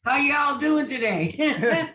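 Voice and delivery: slow, laughing Southern drawl on How y'all doing today; Southern accent. Shown as a demonstration. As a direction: ham up a friendly Southern greeting. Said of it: "Give me some Southern".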